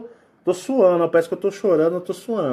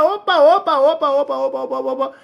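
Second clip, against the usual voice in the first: alarmed; 6 semitones higher, faster than usual. Acted. Alerting that reptilians have arrived, alarmed and higher. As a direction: sound the alarm, startled.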